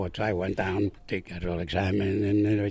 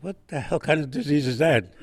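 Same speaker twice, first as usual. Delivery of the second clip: laughing; 4 semitones higher, 3 dB louder than usual. Retold or imitated. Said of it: "he said".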